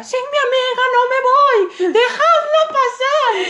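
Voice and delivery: very high, loud, slow laughing shout; dramatic protest. Retold or imitated. Andrea refusing to board without Tania, high laughing shout. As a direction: plead theatrically, high and drawn out. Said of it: "Y Andrea".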